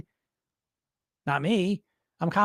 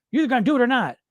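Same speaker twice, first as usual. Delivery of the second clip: ordinary talk, nothing marked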